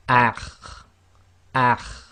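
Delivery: slow, loud "akh" with an exaggerated throat scrape; overemphasized kha. Shown as a demonstration. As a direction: push the kh of "akh" hard and long. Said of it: "sometimes overemphasize this".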